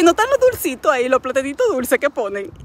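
high, fast, eager begging for the sweet ones; pleading excitement. Retold or imitated. Wife pleading with husband for sweet plantains, high eager begging. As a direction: beg her husband excitedly like a kid. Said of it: "Y yo dije".

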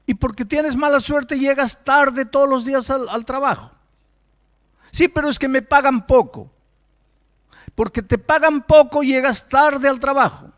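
preaching forcefully to the congregation; predicación enérgica. Acted. (predicación con fuerza, voz alzada)

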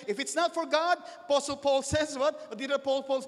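high, loud preaching voice; forceful preaching. Real, not acted. Preacher reminding the church of Paul's words, forceful preaching. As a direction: drive it home, stretching the final words.

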